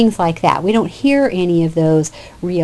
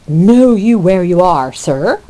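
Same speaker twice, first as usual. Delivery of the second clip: a touch of haughty superiority, louder; older brother demanding respect, lightly voiced; uppity; 5 dB louder, slower than usual. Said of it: "trying to be a little bit uppity".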